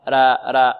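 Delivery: thin, flattened "raa" on را; wrong light ra. Shown as a demonstration. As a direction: repeat را too lightly. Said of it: "خطأ".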